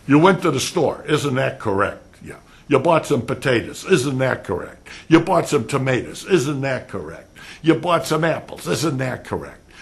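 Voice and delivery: mock lawyer cross-exam. Shown as a demonstration. Teacher showing students how lawyers sound, badgering. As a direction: grill the witness with leading questions. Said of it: "What the heck do you think you sound like?".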